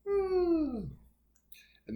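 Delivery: yawning voice exercise. Shown as a demonstration. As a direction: yawn through the words. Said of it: "exercises you can do here is a yawn".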